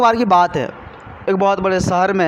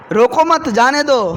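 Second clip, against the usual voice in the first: higher-pitched रोको मत together, then जाने दो; pause after मत; 4 semitones higher, 3 dB louder than usual. Retold or imitated. What the policeman heard, pause after मत. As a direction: lift रोको मत, break before जाने दो. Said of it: "उन्होंने क्या सुना".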